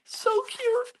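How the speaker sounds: very high squeal of so cute; squeaky cute gush. Real, not acted. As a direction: squeal it like you just saw a kitten. Admiring the cat card.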